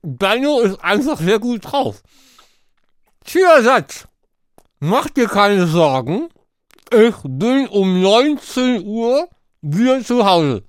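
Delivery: talking round a mouthful of marshmallows; mouth full. Real, not acted. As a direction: cheeks stuffed, words mushy. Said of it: "ich lese dir mit vollem Mund".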